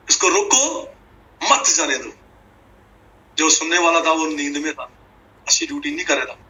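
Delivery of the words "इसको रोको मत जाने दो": रोको pitched high, then a break before मत जाने दो; pause after रोको. Acted. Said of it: "बात वही थी".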